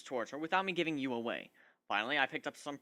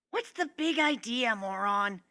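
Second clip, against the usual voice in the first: woken up and cranky, higher pitched; annoyed snap; 7 semitones higher, 3 dB louder, slower than usual. Acted. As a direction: snap at him, irritated. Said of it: "she snapped as she woke up".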